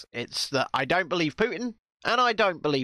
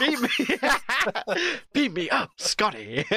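laughing; 7 semitones higher than usual. Pretend. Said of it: "quoting Kirk through laughs".

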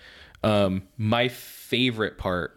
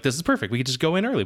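excited; 7 semitones higher, faster than usual. Retold or imitated. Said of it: "I was like".

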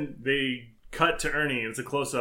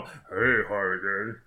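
slow, lowered creep voice; put-on villain, pitched down; comic-book villain voice; 5 semitones lower, slower than usual. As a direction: draw it out low and creepy. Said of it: "I get to make the voice in my own head".

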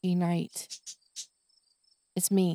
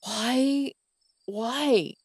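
higher, pleading frustrated why; exasperated why; 5 semitones higher than usual. Retold or imitated. Retelling her frustration at the anxious dog, exasperated. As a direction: throw up your hands at the dog. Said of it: "And I'm like".

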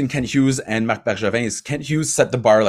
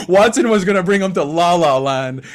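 laughing; 7 semitones higher, 6 dB louder than usual. Real, not acted. Laughing at the hit story.

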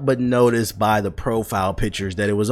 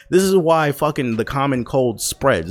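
ordinary talk, nothing marked

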